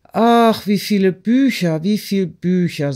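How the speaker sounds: high, loud exclamation of wonder; amazed gasp. A narrator's reading. A peasant hauling the library marvels aloud, high and astonished. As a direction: marvel at the books.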